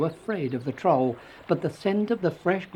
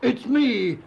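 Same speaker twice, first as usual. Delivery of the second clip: high, bold bellow; big goat standing up to the troll; 9 semitones higher than usual. A narrator's reading. Eldest goat answers the troll, bellowing. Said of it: "bellowed the eldest billy goat Gruff".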